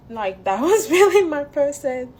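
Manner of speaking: loud laughing cry; a real laugh breaking through the grief; half laughing, half crying, loud; laugh through tears. Real, not acted.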